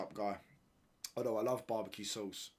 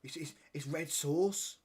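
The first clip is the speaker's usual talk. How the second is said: lightly put-on Birmingham voice, higher pitched; Birmingham accent; 5 semitones higher than usual. Mimicking Birmingham people who question the word ketchup, mock Birmingham accent. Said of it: "these people from Birmingham were like".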